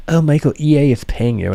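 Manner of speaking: sing-song mock of a nagging fan; mocking accuser. Streamer imitating viewers claiming EA pays him, mocking. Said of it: "they keep saying".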